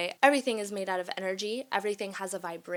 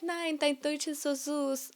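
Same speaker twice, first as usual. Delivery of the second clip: mock fan comment; 7 semitones higher than usual. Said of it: "before y'all come on here like".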